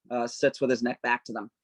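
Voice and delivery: ordinary talk, nothing marked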